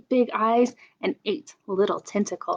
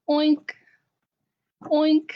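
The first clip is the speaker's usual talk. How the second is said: high snorty pig oink; 6 semitones higher than usual. Shown as a demonstration. Librarian making the pig noise for children, high oink. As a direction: oink it for the kids. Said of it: "All I know is pigs go".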